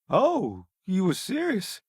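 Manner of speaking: normal talking voice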